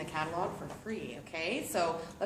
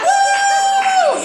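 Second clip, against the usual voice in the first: high, loud whooping cheer; toy cheering; 14 dB louder than usual. Retold or imitated. Host voicing the vibrating toy cheering at the party. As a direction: voice the toy as a thrilled party guest. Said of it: "Look at him, he's like".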